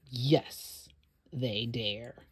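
ordinary delivery, nothing marked

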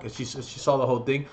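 ordinary talk

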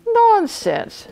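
high-pitched scoff; snorting dismissal. A narrator's reading. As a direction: wave it away scornfully. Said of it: "snorted the captain".

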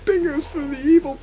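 high, wailing mock dread; mock horror voice. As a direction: ham up the demon panic. Mock monster rant.

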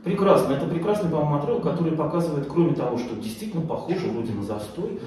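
ordinary talk, nothing marked

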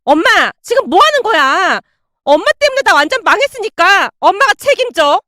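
high, outraged scolding of mother; acted furious daughter outburst; yelling daughter. A narrator's reading. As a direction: blame your mother at the top of your temper. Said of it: "집안이 떠내려가라".

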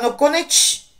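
relieved outburst at the opening door; blurted. A narrator's reading. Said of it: "Hermiona vyhrkla". (vyhrknout netrpělivě)

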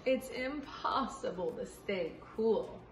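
put-on reading a picture book aloud to kids; story reading. Teacher reading a picture book to children, lively storytime. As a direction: read it out brightly for young listeners.

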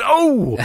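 high drawn-out "oh"; imitated yelp as a muscle is pressed; mock pained oh. Imitating wrestler's muscle being pressed.

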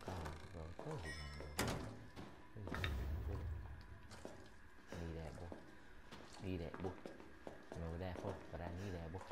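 quiet mumbling. Real, not acted. Quiet muttering while looting.

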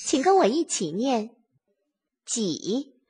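normal talking voice

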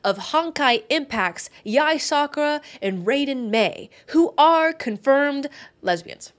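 high, loud, slow proclamation; grand reveal. Pretend. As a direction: proclaim it like a triumphant announcer. Big reveal after a drum roll.

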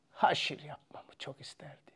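soft unvoiced whispered quote. Narrator quoting the old teacher, whispered. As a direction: whisper it like a confidence.

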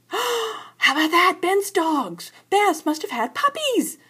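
put-on high gasping child's excitement; light up at the news, high; excited gasp. A narrator's reading. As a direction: cub spotting the puppy sign. Cub excited at puppy sign.

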